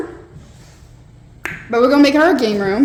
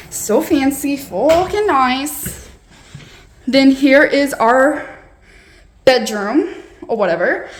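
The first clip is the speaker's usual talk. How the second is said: normal talking voice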